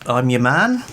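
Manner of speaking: usual speaking voice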